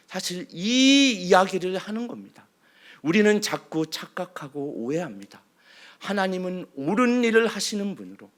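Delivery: ordinary delivery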